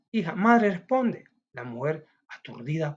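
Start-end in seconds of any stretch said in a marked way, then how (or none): 0.3-1.2 put-on higher, louder child's voice asking; a child startled by mother crying; worried child. Child asks weeping mother why, higher, louder worried voice.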